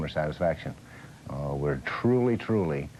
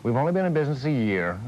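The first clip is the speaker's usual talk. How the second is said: eager pitch; 8 semitones higher, 4 dB louder than usual. Real, not acted.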